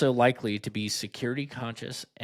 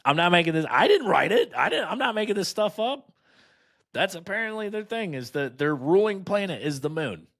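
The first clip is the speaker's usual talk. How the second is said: incredulous; 9 semitones higher than usual. Real, not acted. Protesting he didn't write it.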